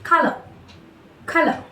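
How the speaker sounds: "color" ending in a schwa, no R; British color. Shown as a demonstration. Teacher showing the British way, R dropped. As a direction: drop the R in "color". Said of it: "whereas in British".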